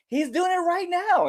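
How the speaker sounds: very high-pitched call-out; tattling accusation. Retold or imitated. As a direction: tell on him, voice shooting up. Wrestler tattling on boyfriend.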